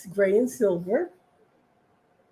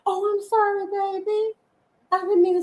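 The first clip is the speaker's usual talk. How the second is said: sweet apology to cat; 10 semitones higher than usual. Real, not acted. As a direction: coo an apology to the startled cat.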